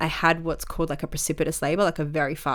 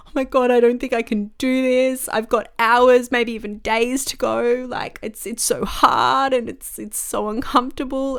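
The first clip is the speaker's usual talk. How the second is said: tearful plea; 6 semitones higher, 4 dB louder than usual. Retold or imitated. Mid-labour, to her husband, distressed and tearful. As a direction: retell the crying plea to your husband. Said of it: "started crying and saying to my husband".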